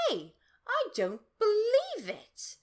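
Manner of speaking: put-on high, loud, slow girl's exclamation; Sherry shocked she's invisible again; disbelief. A narrator's reading. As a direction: squeal it in disbelief.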